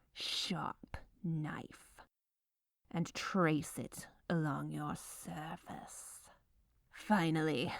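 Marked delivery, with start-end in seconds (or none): 0.1-6.2 soft sinister whisper; menacing whisper. Acted. Baker tracing the knife over dough, menacing whisper. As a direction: whisper like a villain with a blade. Villainous knife cutting narration.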